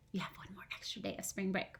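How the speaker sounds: put-on conspiratorial whisper to the kids. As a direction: whisper it like a secret. Secret extra day, whispered aside.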